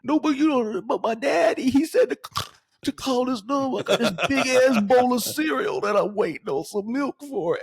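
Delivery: put-on very high, agitated child voice; worked-up chubby kid. Prank caller posing as a child to a scared stranger, worked-up kid voice. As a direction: whine frantically like a little kid. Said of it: "we get real worked up".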